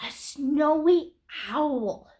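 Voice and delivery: normal talking voice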